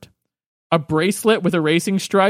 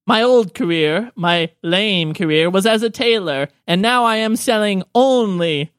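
slightly raised, showy gnome voice; old gnome saleswoman; 3 semitones higher than usual. Acted. Gnome woman unveiling her new trade, showy. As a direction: announce it as an eccentric old gnome.

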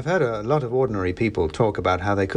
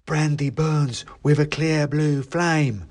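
high, slow rhyming little creature; mouse elf; 5 semitones higher, slower than usual. A narrator's reading. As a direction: recite it like a mouse casting a rhyme. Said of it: "said the mouse".

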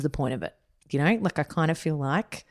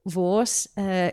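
slow, higher native Danish; speaking Danish; 5 semitones higher, 4 dB louder, slower than usual. Shown as a demonstration. Host thanks podcast listeners in Danish, slow and higher. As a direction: greet the listeners in Danish, slowly. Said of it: "Kai always throws up when I speak Danish".

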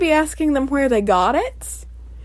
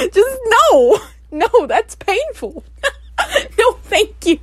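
higher voice, breaking up with laughter; laughing refusal; 8 semitones higher than usual. Real, not acted. Host refusing a broken-hand proposal, laughing. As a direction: refuse through helpless giggles.

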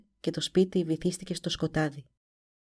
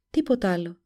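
ordinary delivery

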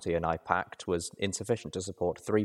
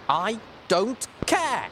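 high, slow, emphatic outburst; cross shout; 3 dB louder, much slower than usual. A narrator's reading. Little Pip cutting off Watcher's excuses, cross shout. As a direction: cut in with each word high and drawn out. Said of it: "I shouted crossly".

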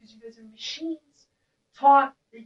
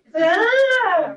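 wordless ecstatic wail; 8 semitones higher, 5 dB louder, much slower than usual. Shown as a demonstration. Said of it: "It feels good, right?".

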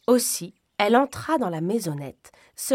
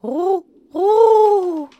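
high, loud sung coo; pigeon cooing; 11 semitones higher, 11 dB louder than usual. Acted. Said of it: "chanta un pigeon".

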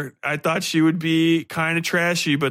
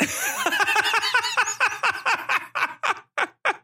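long high burst of real laughter; helpless high laugh; big laugh. Real, not acted. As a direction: crack up at the absurd scene. Laughing at absurd book scene.

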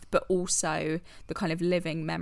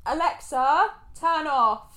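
raised pitch, addressing a voice assistant; calling out a command; 7 semitones higher, slower than usual. Real, not acted. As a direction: call out firmly to the smart speaker.

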